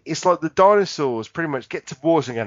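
normal talking voice

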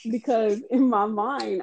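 ordinary talk, nothing marked

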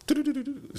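high-pitched "do-do-do" gibberish; babbled stand-in for Spanish; mock rapid Spanish. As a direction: imitate fast Spanish with nonsense syllables. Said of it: "You know, speaking Spanish".

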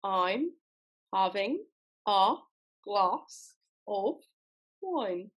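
one word at a time, paused between; slow British attempt, each word separate. Shown as a demonstration. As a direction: dictation pace in put-on British. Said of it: "I'm gonna say it slow".

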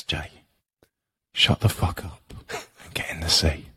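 a low, harsh whisper of the inner voice, mostly breath. Retold or imitated. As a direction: hiss it at yourself like the voice in your head. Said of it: "second voice that comes into my head and goes".

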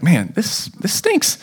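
soft grumble ending in a high squeak on stinks; mock whine of frustration; hammed-up annoyed whine; fed up. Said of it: "you're like".

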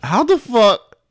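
high-pitched, exasperated question; mock incredulous. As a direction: demand it in a high mock-indignant voice. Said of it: "He said".

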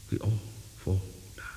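whispered last line of the rhyme; fading ghostly whisper. A narrator's reading. Ghost children fading away, whispering the last line. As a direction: let it vanish into a whisper. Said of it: "laughing and singing as they went".

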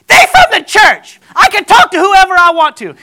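high, very loud defiant yelling; retold wife's scream, stretched and loud; screaming back. Wife answering her jealous husband on the train, screaming back. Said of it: "they scream at each other for a second".

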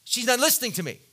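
blurting out the hurt thought aloud; frustrated inner voice. Retold or imitated. Preacher voicing his frustrated thought about his wife, loud and resentful. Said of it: "I translate that into".

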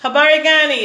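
greeting called out high and loud; hearty pretend Kwanzaa greeting. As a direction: sing it out like a festive hello.

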